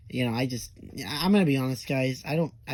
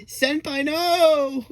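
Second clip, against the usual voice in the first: high, loud anime-style wail; hammed-up death cry, rising to a yell; dying words; 12 semitones higher, 6 dB louder, much slower than usual. Defeated hentai guy's dying words, melodramatic high cry. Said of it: "his dying words was like".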